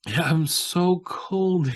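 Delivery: drawn out very slowly and pitched high; mocking whiny imitation. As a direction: stretch out "so cold" like a whiny complainer. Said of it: "he says".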